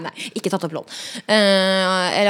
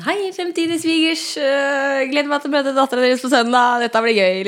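higher, cheerful greeting, slow start; friendly hello to future in-laws; imitating boyfriend's video; 5 semitones higher, 4 dB louder than usual. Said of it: "og så var sånn". (munter hilsen til svigerforeldre)

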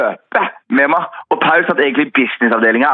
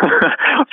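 a real high-pitched laugh; sudden high giggle; high burst of laughter; 12 semitones higher than usual. Real, not acted. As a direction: crack up mid-story. Laughing at his own story.

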